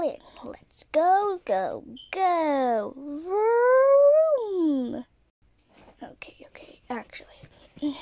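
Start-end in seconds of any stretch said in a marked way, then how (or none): 3.0-5.0 loud, high vroom; car engine vroom. Pretend. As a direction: make the car engine noise. Driving toy car.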